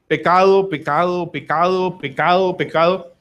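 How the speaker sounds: PECADO repeated in an even, measured beat; repetitive listing. Shown as a demonstration. Preacher naming what couples show, repetitive listing. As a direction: hammer the first pecado, then keep the beat.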